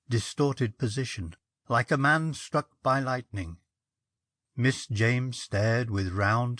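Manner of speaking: ordinary delivery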